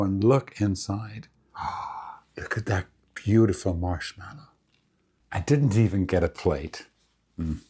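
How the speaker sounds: normal talking voice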